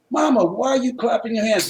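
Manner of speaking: a hint of an insistent little boy.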